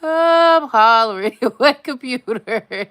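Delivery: hollering through a laugh; laughing shout. Real, not acted. Woman laughing and shouting back to her partner offscreen. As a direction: call back loud while cracking up. Laughing while calling across the house.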